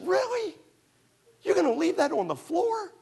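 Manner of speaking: very high mock incredulous wife. Wife challenging her husband about the floor, in a very high incredulous voice. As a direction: push back in disbelief, hammed up.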